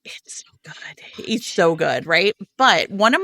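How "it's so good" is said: hushed, breathy aside; whisper. Real, not acted. Host whispers her praise of the book to co-host. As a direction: lean in and whisper it.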